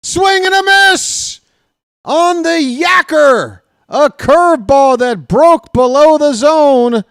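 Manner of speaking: high, loud triumphant call; excited strikeout call. Acted. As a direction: shout the strikeout like the game is won. Strikeout ends the inning.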